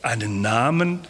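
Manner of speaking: mock embarrassed puzzled echo. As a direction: caught off guard, unsure what to answer. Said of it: "verlegen schauen und sagen".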